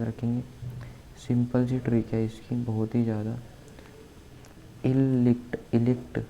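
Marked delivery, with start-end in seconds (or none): none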